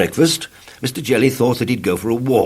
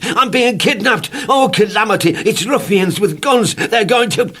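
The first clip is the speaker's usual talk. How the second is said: panicky shouts; 11 semitones higher, 4 dB louder than usual. Acted. Mr Jelly panics at leaf.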